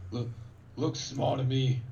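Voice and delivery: slow, boastful call to the group; Buck. Acted. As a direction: say it big and slow, sizing them up. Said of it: "Buck looks over at the group and, you know, says".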